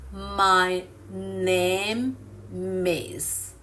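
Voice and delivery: "My name is" very slow, "is" starting on the m of "name"; slow linked words. Shown as a demonstration. Teacher repeating for learners, "My name is" linked very slowly. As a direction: stretch out "My name is" as "my, name, mis". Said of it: "native speakers often say".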